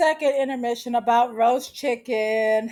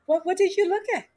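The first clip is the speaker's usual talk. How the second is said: mock surprise; 7 semitones higher, faster than usual. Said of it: "Megan was like".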